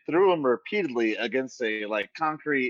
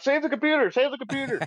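high-pitched frantic plea; urgent, comic cry for rescue; panicked mock cry; 14 semitones higher than usual. Man falling with his laptop, panicked mock cry.

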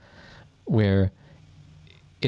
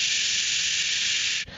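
soft unvoiced shh; static hiss; 4 dB quieter than usual. Retelling the ruined recording, a soft unvoiced hiss. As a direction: hiss like dead audio. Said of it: "the whole thing was like".

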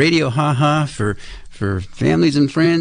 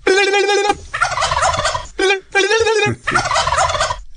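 high squeaky laugh. Acted. Host reacts to teaser sound.

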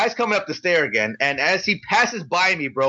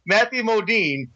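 usual speaking voice

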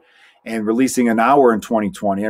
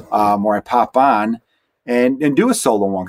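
ordinary talk

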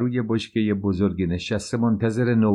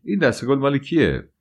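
higher-pitched exclaimed question; crying out; 6 semitones higher, 3 dB louder than usual. A narrator's reading. Man calling to a passing servant about flowers, crying out. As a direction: call it out with a raised pitch. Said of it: "فوشیری فریاد برآورد".

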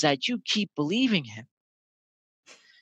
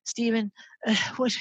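higher, mildly upset little sister; retold crying; 7 semitones higher than usual. Crying baby sister comes to her brother, mock upset. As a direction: imitate a crying sister, lightly. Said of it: "She'd be crying".

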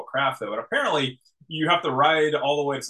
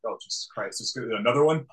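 exasperated groan; 6 semitones lower, 4 dB quieter than usual. Retold or imitated. Megatron seeing two Starscreams.

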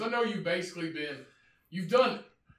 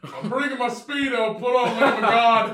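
hammed-up excited shout; 8 semitones higher, 12 dB louder than usual. Acting out the honeymoon.

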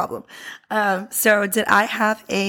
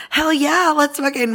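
excited; 7 semitones higher than usual. Retold or imitated. Friend Maria cheering at a free shopping trip, excited whoop. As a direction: throw it out like a thrilled friend.

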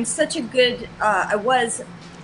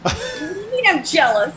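indignant protest; 6 semitones higher than usual. Retold or imitated. Arguing with boyfriend.

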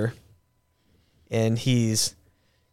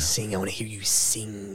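creepy mock demand; 4 semitones lower, 10 dB quieter than usual.

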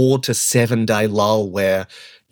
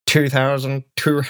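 Richie Benaud attempt. Friend put on the spot, laughing mock Benaud. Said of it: "I would like you to say".

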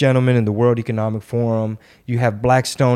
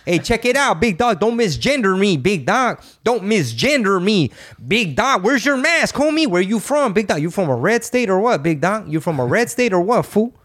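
higher-pitched Chicano homie, teasing the viewers; 8 semitones higher than usual. Acted. Host mocking anti-mask viewers in a homie voice, higher and teasing. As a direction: play a street homie calling people big dog, pitched up.

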